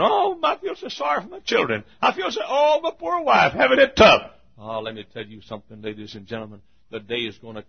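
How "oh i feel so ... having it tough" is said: high, whiny mock complaint; mock griper. Preacher mocking complainers in his flock, whining high. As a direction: mimic a self-pitying whiner. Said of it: "we got too much griping going".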